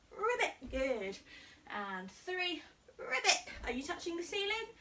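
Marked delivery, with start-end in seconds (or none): 0.1-0.5 high playful croak; teacher playing a frog for children; frog ribbit. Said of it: "five frog jumps". 3.0-3.4 high playful croak; teacher playing a frog for children; frog ribbit. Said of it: "five frog jumps".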